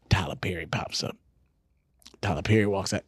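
usual speaking voice

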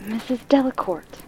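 lightly voiced anxious woman; a hint of an agitated woman. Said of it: "in an agitated voice".

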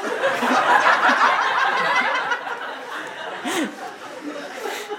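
long high unvoiced laugh; real laughter. Real, not acted. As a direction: burst out laughing, breathless. Laughs at old diary line. (ademloos uitlachen)

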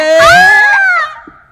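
loud, high "aah" yell; high-pitched whoop at full volume; excited scream. Real, not acted. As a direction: let out a long high hype scream. Shouted cry in show intro.

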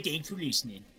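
ordinary delivery

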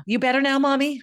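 a hint of a worried little son; imitating a small boy. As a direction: ask it sweetly, like a little kid. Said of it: "He was all".

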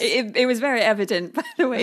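talking through giggles; laughing tease. Real, not acted. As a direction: tease a friend, breaking into laughter. Teasing co-host while laughing.

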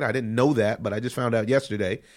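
ordinary talk, nothing marked

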